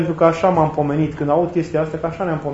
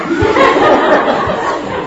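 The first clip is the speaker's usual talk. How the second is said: loud high laugh; 6 dB louder than usual. Real, not acted. Storyteller amused by the misunderstanding, loud high laugh. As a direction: burst of high-pitched laughter.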